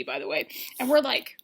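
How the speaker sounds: ordinary talk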